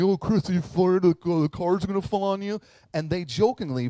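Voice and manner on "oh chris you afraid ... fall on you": mocking friends. Friends tease Chris about the car, mocking. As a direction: taunt him like a smug buddy. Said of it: "they begin to mock me".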